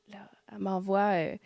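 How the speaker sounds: usual speaking voice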